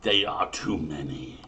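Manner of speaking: normal talking voice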